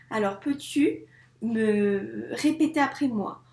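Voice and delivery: ordinary delivery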